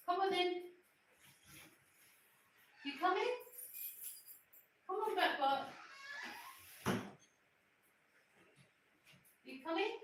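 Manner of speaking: high, soft pet-coaxing voice; coaxing a dog. Real, not acted. Owner outside urging her dog to come, coaxing. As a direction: coax the dog along with lifted questions.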